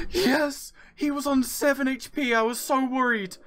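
high-pitched, elated shouting; relieved triumph. Real, not acted. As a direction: burst out with real relief and joy. Wins fight after fearing death.

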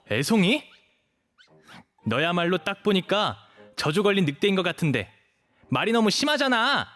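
huffy and affronted; indignant retort. Acted. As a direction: fire back, offended, pitch climbing to the end. Stung by insult, snaps back.

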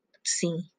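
hushed, secretive aside; whisper. Real, not acted. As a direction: lean in and whisper.

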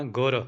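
ਗੁਰ with the r fully sounded; full final r. Shown as a demonstration. Teacher showing learners the word, full r in ਗੁਰ. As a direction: finish ਗੁਰ on a complete, released r. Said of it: "ਗੁਰ ਦਾ ਰਾਰਾ ਪੂਰਾ ਬੋਲਣਾ".

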